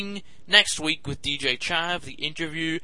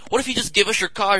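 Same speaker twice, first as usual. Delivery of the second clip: eager salesman pitch; 5 semitones higher, 5 dB louder, faster than usual. Retold or imitated. Clerk pushing card.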